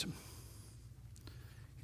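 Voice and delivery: whisper. Real, not acted. Preacher drops to a whisper.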